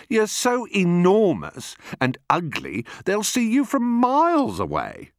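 high-pitched insulting cry; shrieky scornful crocodile; mocking not-so-big crocodile. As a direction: jeer with rising exasperation.

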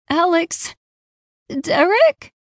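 high rising call; anxious calling out. A narrator's reading. Searching the haze for family, anxious high calls. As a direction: call out searchingly, pitch lifting sharply.

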